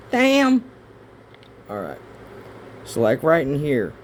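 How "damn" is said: frustrated outburst. Real, not acted. Frustrated at missing pen.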